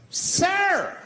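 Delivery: high-pitched indignant shout; freaked-out passenger. Acted. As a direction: cry out in alarm at someone's outrage. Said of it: "people freaked out".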